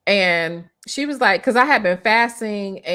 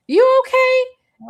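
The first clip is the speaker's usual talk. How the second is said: sister's voice; 5 dB louder, much slower than usual. Retold or imitated. Sister's concerned question voiced.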